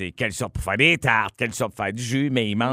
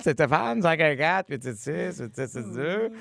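voix aiguë et traînante d'un ami; message vocal de chum; 6 semitones higher than usual. Retold or imitated. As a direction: imiter un ami qui laisse un message vide. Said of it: "puis que ton message, c'est". (voix aiguë de chum au répondeur)

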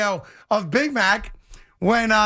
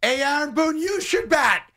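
exasperated fan's yell, pitched up; re-enacted yelling at TV; 6 semitones higher than usual. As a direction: heckle the manager through the screen. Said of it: "I was yelling at the screen".

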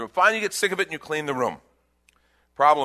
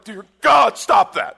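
exasperated shout; 5 semitones higher, 9 dB louder, slower than usual. Retold or imitated. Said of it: "the single person is like".

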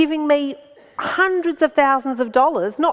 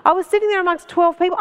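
ordinary delivery

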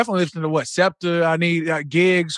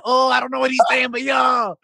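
higher, hollered enthusiasm; hyped reaction; 6 semitones higher than usual. Acted. Friend reacting to French trap he can't understand, hyped holler. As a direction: exclaim it like the beat just hit. Acting out loving French rap.

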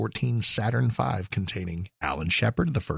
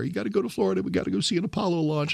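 put-on pleading kid voice, pitched higher; a boy nagging his parents for a launch trip; badgering kid; 10 semitones higher, faster than usual. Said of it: "After badgering my parents".

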